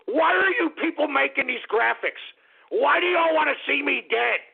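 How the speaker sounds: high, aggrieved holler; indignant yell. Real, not acted. Host asking listeners why they make these graphics, indignant high yell. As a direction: demand an answer in a high outraged yell.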